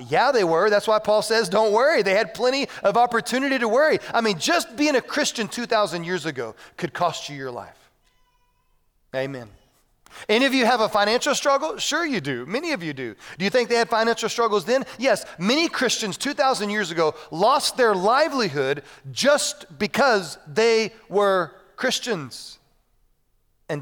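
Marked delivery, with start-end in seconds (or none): 0.1-7.9 loud, raised preacher's voice; emphatic sermon delivery, pitched up; forceful preaching. Real, not acted. 10.3-22.6 raised, emphatic preacher's voice; insistent sermon, ending word by word; forceful preaching. Real, not acted.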